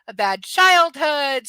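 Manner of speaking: loud, slow exasperated. Real, not acted. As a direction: blurt it out loudly. Ranting list.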